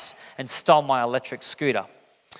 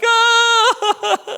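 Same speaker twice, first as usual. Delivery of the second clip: put-on high wailing child's cry; a small son's sudden wail over the loss; 5 dB louder than usual. Son wailing at dad over the stolen scooter, high crying cry. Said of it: "my son Zeke's crying".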